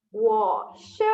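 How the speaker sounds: ordinary talk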